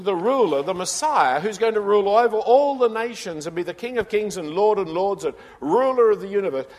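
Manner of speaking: loud, high, building proclamation of the king; rising preaching. Real, not acted. Preacher to the congregation, proclaiming the ruling king in a loud rising voice. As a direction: build it up to a ringing climax.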